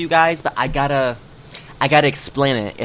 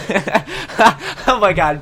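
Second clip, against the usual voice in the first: laughing; 6 semitones higher, much slower than usual. Real, not acted. Laughing at friend's bad day.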